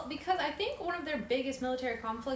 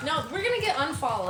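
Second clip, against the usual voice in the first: laughing; 5 semitones higher, 5 dB louder than usual. Real, not acted. Laughing after singing.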